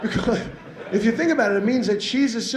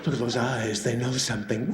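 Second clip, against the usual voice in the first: soft, low doting coo over a cat; mock cooing owner; 7 semitones lower, 5 dB quieter than usual. Cat lover cooing over his pet, softer and lower. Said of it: "we go".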